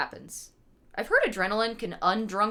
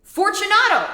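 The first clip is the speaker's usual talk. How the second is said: louder, much higher call; calling again; 13 semitones higher, 11 dB louder than usual. Acted. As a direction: call out harder the second time. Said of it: "I called again".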